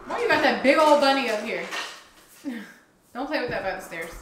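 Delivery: ordinary delivery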